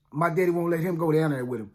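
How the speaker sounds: ordinary delivery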